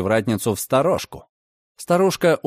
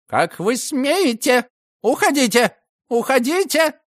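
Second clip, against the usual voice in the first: indignant yelling; 12 semitones higher than usual. A narrator's reading. Landlady shrieking at police to get out, very high. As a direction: shriek them out of the house. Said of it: "завопила женщина".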